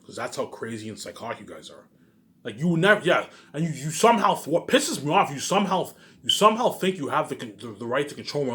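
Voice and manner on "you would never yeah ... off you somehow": normal talking voice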